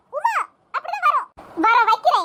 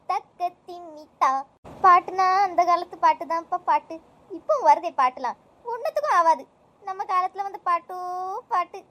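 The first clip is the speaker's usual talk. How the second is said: put-on old mother; 8 semitones lower than usual. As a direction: play the old mother, wrapped up in her songs. Plays old mother enjoying songs. (பழைய பாட்டில் மயங்கும் வயதான அம்மா குரல்)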